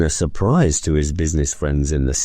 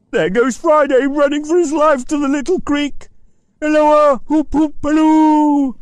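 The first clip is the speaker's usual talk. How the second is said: very high, loud hallooing; calling out; 4 dB louder than usual. Acted. Scrooge hallooing Friday in his vision, loud. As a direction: holler after a runner.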